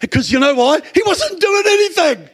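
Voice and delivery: rising preacher's shout; preaching shout. Real, not acted. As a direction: shout the point out high.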